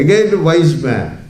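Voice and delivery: ordinary talk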